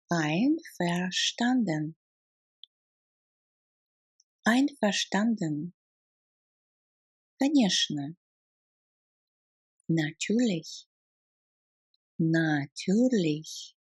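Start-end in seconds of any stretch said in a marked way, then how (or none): 0.1-2.0 syllable by syllable. Shown as a demonstration. Teacher models the German for agreed, syllable by syllable. Language drill, syllable take. 12.2-13.7 stretched, careful syllables; slow syllables. Shown as a demonstration. Teacher models the German for of course, slow syllables. Language drill, slow take.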